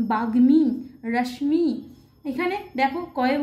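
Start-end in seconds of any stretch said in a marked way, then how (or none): 0.1-0.6 বাগ্মী with g and m both sounded, louder; Sanskrit pronunciation. Shown as a demonstration. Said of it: "প্রত্যেকটি বর্ণের উচ্চারণ হবে". 1.1-1.7 রশ্মি with sh and m both sounded; Sanskrit pronunciation. Shown as a demonstration. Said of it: "প্রত্যেকটি বর্ণের উচ্চারণ হবে".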